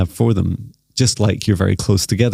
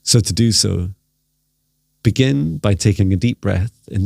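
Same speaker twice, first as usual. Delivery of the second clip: guided meditation. Acted. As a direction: gently lead them into stillness.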